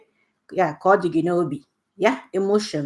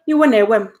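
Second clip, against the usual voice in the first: mock angry; 4 semitones higher, 7 dB louder than usual. Shown as a demonstration. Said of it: "Anger, iwe".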